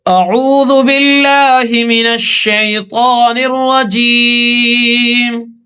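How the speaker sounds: measured, drawn-out tilawat; Quran recitation. Acted. Teacher begins the surah for students in slow recitation. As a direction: recite slowly with long vowels.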